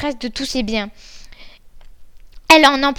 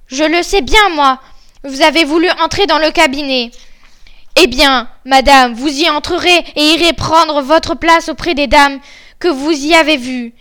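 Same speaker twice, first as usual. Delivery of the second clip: loud, accusing husband confronting his wife; menacing Bluebeard; 2 semitones higher, 7 dB louder than usual. Acted. Bluebeard tells his wife she will join the dead ladies, loud and menacing. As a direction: pronounce the sentence on her, loudly.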